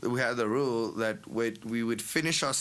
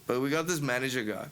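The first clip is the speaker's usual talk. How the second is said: normal talking voice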